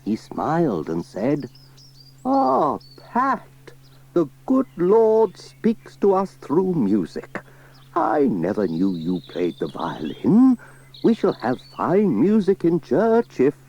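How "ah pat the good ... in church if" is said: vicar voice. Acted. Vicar voice in story.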